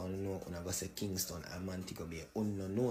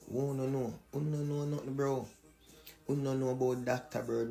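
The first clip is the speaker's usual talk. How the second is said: raised voice, scolding pitch up; 5 semitones higher than usual. Real, not acted. Taunting diaspora, raised voice.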